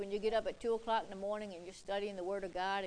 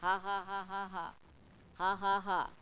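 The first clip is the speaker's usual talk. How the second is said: measured, deliberate ha-ha laugh; mock laugh, spelled out in ha-has; imitated laughing. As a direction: act out laughing in short, separate bursts. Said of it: "lay there in bed and start laughing".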